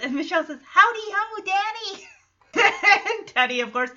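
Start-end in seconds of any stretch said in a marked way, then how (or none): 0.6-2.1 put-on high, sing-out child's greeting; lightly voiced little girl. Michelle answering Danny's greeting, lightly voiced little girl. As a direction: imitate a small child calling hello.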